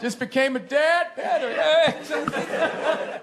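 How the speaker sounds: high mock yell of a proud new dad. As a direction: announce it like a crazy person on the platform. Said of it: "yell that on the platform like a crazy person".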